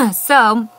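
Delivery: clears a dry throat; a quick clearing of the throat. Real, not acted. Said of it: "excuse me, my throat is so dry".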